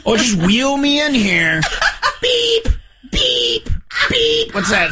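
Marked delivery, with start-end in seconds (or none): 0.0-1.6 high-pitched fretting, laughing at the end; mock panic. Host mocking co-host who only worries about the show, mock panic. 2.2-4.5 high, steady electronic beep; imitated machine beep. Host acting out his hospital stay, imitated beeps.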